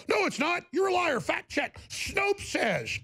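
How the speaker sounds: high mocking yelp; mocking fact-checkers. Mocking the fact-checkers who call Trump a liar, high squeal. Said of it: "They go".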